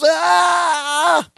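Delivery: hammed-up cry of pain; mock scream. Imitating Wainwright shot off screen, mock scream. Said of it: "we hear Mister Wainwright scream".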